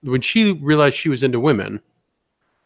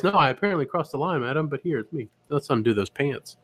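genteel lecher, dropping to a murmur; polite creep; 6 dB quieter than usual. Acted. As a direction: slide from apology to hushed creepy come-on.

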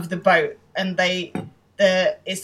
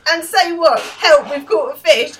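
high, loud sarcastic tirade; mock the friend's stupid plan, loudly; exasperated rant; 8 semitones higher, 6 dB louder than usual. [name] ranting at Jay.

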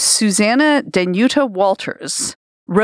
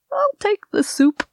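put-on high, creaky old man; 13 semitones higher than usual. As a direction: squeak it out like a deaf old man. Old man punchline in joke.

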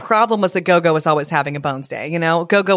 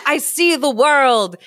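slow, higher rooster voice; crowing rooster; 8 semitones higher, slower than usual. A narrator's reading. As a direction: stretch it out like a proud crow. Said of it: "flapped his wings and crowed".

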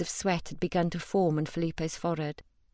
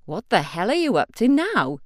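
exasperated Ian; 3 semitones higher, 7 dB louder than usual. Acted. Ian reacts to the request.

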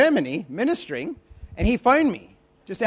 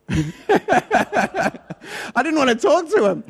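laughing through the words, higher; 7 semitones higher, 3 dB louder, much slower than usual. Real, not acted. Admitting he ducked the prophet, laughing. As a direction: giggle out the confession.

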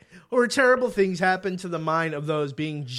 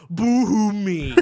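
mock boo-hoo; 3 semitones higher, 4 dB louder, slower than usual. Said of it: "And someone's like".

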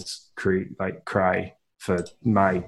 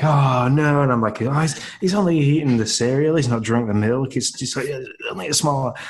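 panicked; 5 semitones higher than usual. Retold or imitated. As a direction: rush it out in panic. Panicked reassurance to self.

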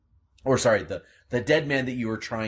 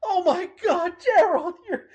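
high, loud sobbing cry of relief; joyful crying; 6 dB louder than usual. Acted. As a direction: weep his name with joy. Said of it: "he's just crying".

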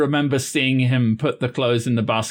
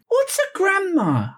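high creature voice. Acted. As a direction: ask like a baffled little creature. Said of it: "Darkonius is like".

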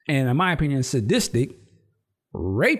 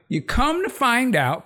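normal talking voice

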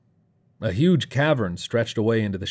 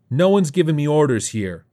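raised, higher-pitched defiance; shouting; 7 semitones higher, 6 dB louder than usual. A narrator's reading. Morley rejects orders from his gang, shouting. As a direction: loudly assert who is in charge. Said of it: "Morley shouted".

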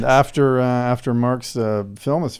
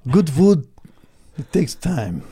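put-on Italian accent; 4 semitones higher, slower than usual. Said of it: "It was a really bad Italian accent".

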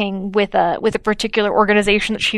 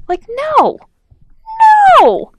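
incredulous no; 5 dB louder than usual. Real, not acted. Incredulous rejection.

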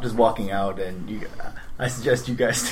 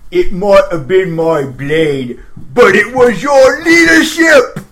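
flattering orc; 4 semitones higher, 10 dB louder, slower than usual. Acted. Player flatters big orc.

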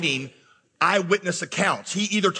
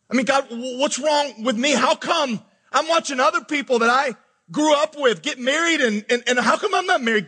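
raised pitch, aggrieved complaint; pleading with God; 7 semitones higher than usual. Retold or imitated. Pastor voices a single believer asking God why, pleading. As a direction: voice a frustrated plea to God.

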